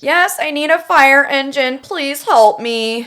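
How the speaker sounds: put-on high, pleading frightened girl; 911 caller. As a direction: beg for help, panicked. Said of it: "I am going to be Melissa".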